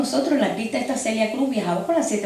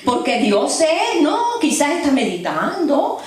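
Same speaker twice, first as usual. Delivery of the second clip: slow, higher, laughing jeer; mocking taunt; 5 semitones higher than usual. Elijah mocking the prophets of Baal, slow laughing jeer. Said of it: "Elías se burlaba de ellos diciendo".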